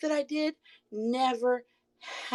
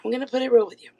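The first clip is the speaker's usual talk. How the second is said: ordinary delivery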